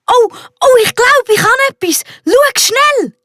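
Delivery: very high, breathless thrill; boy crying out at something on his fishing line; excited. A narrator's reading. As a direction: squeal with a catch on the line. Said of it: "sagt der Max aufgeregt".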